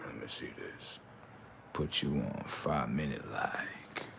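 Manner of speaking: very soft, low, near-whisper; slow down and murmur it under your breath; hushed mock indulgence. To an attention-seeking commenter being timed out, hushed murmur. Quietly dealing with an attention seeker.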